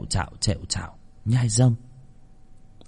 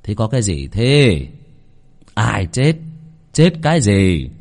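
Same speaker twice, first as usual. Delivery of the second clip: gruffly irritated, louder short questions; irritated demand; 3 dB louder than usual. Acted. Husband roused from bed by shouting, irritated. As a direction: demand to know what the fuss is.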